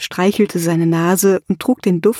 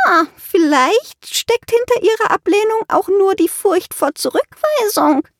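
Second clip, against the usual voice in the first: much higher witch, tittering through words; giggling; 12 semitones higher than usual. A narrator's reading. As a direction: witch mocking the patient's fear. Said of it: "kicherte sie".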